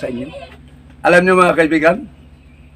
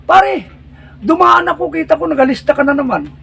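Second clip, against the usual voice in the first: higher, prying buddy's voice; nosy barkada; 9 semitones higher than usual. Acted. Friend at the corner questioning the one with the list, high and nosy. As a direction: quiz your friend about the paper.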